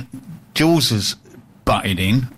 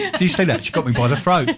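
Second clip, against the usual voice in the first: ordinary talk, nothing marked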